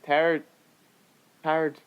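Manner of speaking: ordinary delivery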